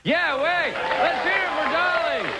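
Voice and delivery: rally the crowd to cheer; mock cheering. Cheering for Dali's birthday.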